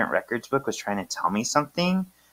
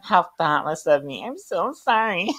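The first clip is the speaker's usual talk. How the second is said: high, gushing mock apology; hammed-up high apology with giggles; Mister Rada; 10 semitones higher, 4 dB louder than usual. Apologizes to Phoebe for assuming, high exaggerated contrition.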